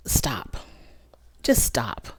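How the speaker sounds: soft exasperated whisper; hushed plea to quit; whispered stop. Real, not acted. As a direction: breathe it out, fed up. Exasperated at the rewatch.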